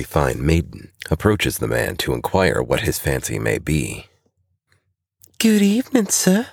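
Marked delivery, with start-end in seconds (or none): 5.4-6.5 high, bright flirty waitress voice; cheerful waitress. A narrator's reading. Waitress greeting a bar customer, high and cheerful. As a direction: greet him sweetly, a touch flirtatious. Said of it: "says the waitress cheerfully".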